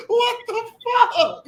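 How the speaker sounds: high, slow, stunned; shocked boss. Retold or imitated. Boss finding the park wrecked, slow high disbelief. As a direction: drag it out in disbelief. Vincent sees the broken park.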